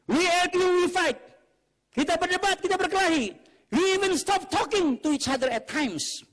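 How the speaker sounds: high, loud, emphatic declaiming; impassioned shouting. Shown as a demonstration. Preacher quoting a saying on family fights, shouting. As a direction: shout out each phrase of the family quarrels.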